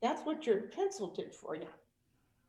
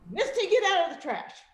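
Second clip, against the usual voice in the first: sharp, louder, higher scold; scolding the dog; 7 semitones higher, 9 dB louder than usual. Retold or imitated. Owner scolding Misty the dog, sharp and loud. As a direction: tell the dog off. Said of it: "animals understand".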